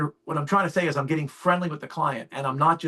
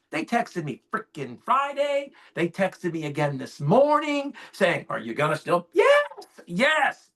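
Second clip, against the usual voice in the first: high, drawn-out exasperation; frustrated; 4 semitones higher, slower than usual. Retold or imitated. Man retelling repeated confirmation texts, exasperated. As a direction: stretch the words out in mounting annoyance. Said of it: "I ain't getting frustrated".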